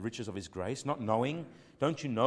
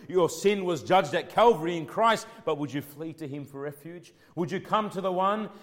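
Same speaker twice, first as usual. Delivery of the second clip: loud pleading preaching; 6 semitones higher, 8 dB louder than usual. Acted.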